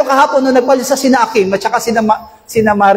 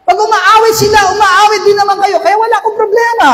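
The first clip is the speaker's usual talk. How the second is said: fervent preaching; 12 semitones higher than usual. Real, not acted. Pastor rousing the congregation about their hidden problems, shouting high and fervent. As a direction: preach it out to the whole church at full force.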